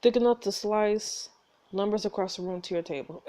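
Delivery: normal talking voice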